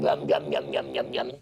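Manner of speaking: deep growled yum yum; munch low like a hungry dinosaur. Acted. Dinosaur pretending to eat child, deep growl.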